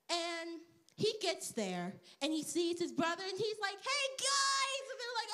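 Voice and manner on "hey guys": put-on high-pitched boyish greeting; young David. Young David greeting his brothers, high and boyish. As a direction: call out in a high kid voice.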